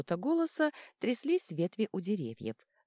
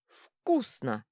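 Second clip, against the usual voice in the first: lower, louder velvety voice of a handsome man; rich handsome voice; 3 semitones lower, 4 dB louder than usual. A narrator's reading. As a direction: purr it like a handsome charmer. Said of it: "густым голосом красавца". (густой голос красавца)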